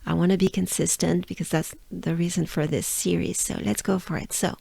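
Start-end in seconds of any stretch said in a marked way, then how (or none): none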